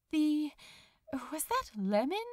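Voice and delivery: plain reading voice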